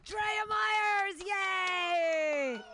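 loud cheering call of a name; announcer shout. Real, not acted. Host hyping the audience with a cheering shout. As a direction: hype up the crowd for the comic.

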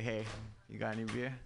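Matthew McConaughey impression. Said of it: "horrible Matthew McConaughey impression".

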